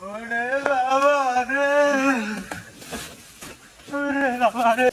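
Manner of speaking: slow, stretched cry of alarm; frightened wail. Acted. Walker crying out at a fright, terrified wail. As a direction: wail it out in terror, drawing out the words.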